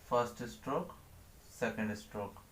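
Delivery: soft, low counting of each stroke; quiet stroke count. Shown as a demonstration. Teacher counts strokes softly while writing. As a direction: murmur the count while drawing.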